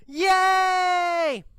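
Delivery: high, long-held cheer; drawn-out, high-pitched jubilant shout. A narrator's reading. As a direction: cheer like a freed class. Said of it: "The students cheer".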